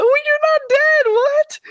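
high, loud, disbelieving squeal; shocked relief. Retold or imitated. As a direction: blurt it out in stunned relief. Friend wakes from being knocked out.

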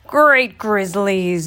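very slow moaning lament. Acted. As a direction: moan it in despair.

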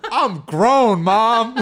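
high, loud, laughing brag; laughing kid. Retold or imitated. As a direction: shout it as a kid who thinks he's grown, cracking up. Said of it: "I was like".

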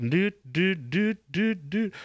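high sung doot doots; doot doot intro. Pretend. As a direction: hum the intro tune with doots. Intro music imitation.

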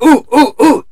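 high, loud, eager oohs; hammed-up excited ooh-ooh; retold eagerness. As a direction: burst out like someone itching to answer. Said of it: "I was like".

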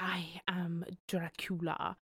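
lightly voiced vampire line; a hint of a grand vampire announcing himself; Dracula. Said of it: "he's like".

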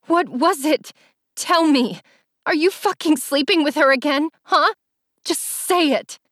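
high-pitched, hurt interrogation; heartbroken demand. A narrator's reading. Allie asks Jace if he is cheating, heartbroken. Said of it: "My voice was filled with such heartbreak and hurt".

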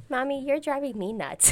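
imitating sassy little girl. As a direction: throw it back in a little-kid voice. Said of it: "the little girl's like".

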